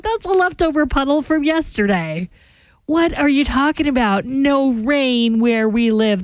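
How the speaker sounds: telling husband there was no rain, amused disbelief; incredulous retort. Retold or imitated. Retorting to husband about puddle.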